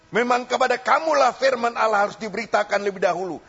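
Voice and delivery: loud, high preaching voice, hammering each phrase; fiery preaching. Acted. Preacher warning the congregation about rejecting the word, fiery. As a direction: preach it hard, pressing the warning home.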